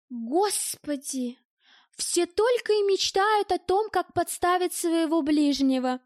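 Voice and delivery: high, drawn-out exasperated scorn; nasty scoffing. A narrator's reading. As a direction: scoff slowly at them all. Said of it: "Нехорошо рассмеялась дамочка".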